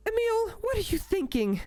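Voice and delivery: high, put-on female voice, mock scandalized; a flustered sister gasping at her brother; sister Amelia's voice. Sister scolding Emil over the phone, high, flustered.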